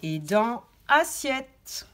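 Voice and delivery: «assiette» drawn out slowly; slow articulation. Shown as a demonstration. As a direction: stretch «assiette» to hear each sound. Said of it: "il faut dire le mot et il faut l'écouter". («assiette» très lentement)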